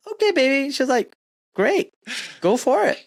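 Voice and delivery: high-pitched imitation of an agreeable wife; imitated wife. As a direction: pitch it up as the wife giving approval. Retold wife's reaction to his plan.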